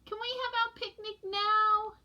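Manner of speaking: put-on very high, small child voice; little penguin voice. A narrator's reading. Penguin chick Pog pleads with parents, squeaky high. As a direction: ask like an eager tiny chick. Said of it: "he said".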